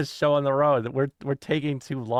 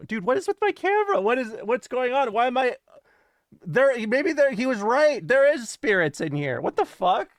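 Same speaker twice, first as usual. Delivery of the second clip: startled panic; 6 semitones higher than usual. Real, not acted. Camera glitch, spirits joke.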